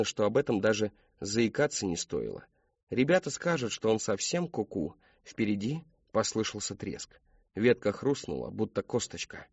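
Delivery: ordinary delivery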